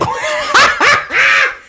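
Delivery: high shrieking laughter. Real, not acted. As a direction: burst into a screaming laugh. Reacting to gong.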